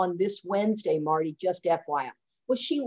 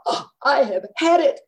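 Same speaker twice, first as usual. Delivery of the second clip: high, loud exasperated outburst; acted exasperation; fed-up anger; 7 semitones higher, 6 dB louder than usual. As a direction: re-enact reaching the end of your rope. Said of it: "I am getting angrier and angrier".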